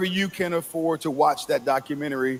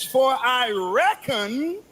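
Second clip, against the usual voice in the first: Southern twang; 6 semitones higher, slower than usual. Shown as a demonstration. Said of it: "I hear that Southern twang".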